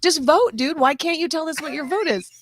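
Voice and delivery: high-pitched, pleading complaint; mock exasperation. Teasing a listener who won't just vote, mock exasperated. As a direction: plead with him in playful exasperation. Acts out exasperation at voter's message.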